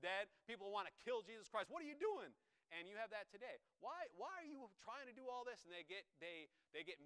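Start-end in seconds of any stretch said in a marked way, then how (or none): 1.7-2.3 high, loud mock protest; indignant challenge. People angry at Jesus for raising Lazarus, indignant challenge. Critics confronting Jesus, acted. 3.8-5.6 high-pitched imitation of complainers; exasperated objection. Critics questioning your mission work, exasperated objection.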